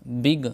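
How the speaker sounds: बिग with a short ई sound; English word big. Shown as a demonstration. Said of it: "मिसाल देखिए". (छोटी ई के साथ बिग)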